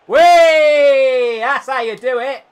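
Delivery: high, loud playful squeal; gleeful squeal. Pretend. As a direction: squeal with glee. Celebrating a skill move.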